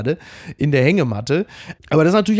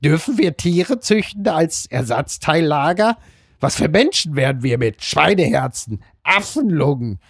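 Homer Simpson. Acted. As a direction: read the column aloud as a comic character, dragging each phrase. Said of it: "mit so einer Homer Simpson-Stimme liest". (getragen vorgelesen mit komischer Zeichentrickstimme)

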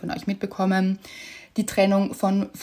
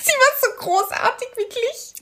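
much higher, almost tearful; touched gushing; 13 semitones higher than usual. Real, not acted. Cohost gushing, moved, about the lovely official. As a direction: gush with emotion.

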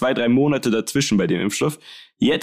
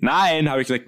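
ordinary talk, nothing marked

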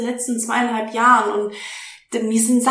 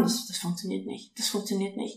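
much softer, a muttered inner doubt; 3 semitones lower, 13 dB quieter than usual. Retold or imitated. Quoting the doubting voice in our heads, much softer. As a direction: drop to a hush of self-doubt. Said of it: "wir immer im Kopf haben".

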